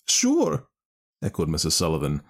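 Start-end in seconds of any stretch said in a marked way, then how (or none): 0.1-0.7 high, distressed Irish mother; grieving mother voice. Acted. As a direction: lament her stolen child. Mother echoes.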